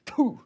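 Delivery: high, whispery spit sound; mock spitting noise. Shown as a demonstration. Host acting out scornful spitting, mock spit. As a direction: blow out an airy, high-pitched spit.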